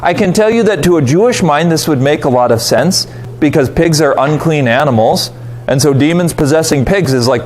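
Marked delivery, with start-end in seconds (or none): none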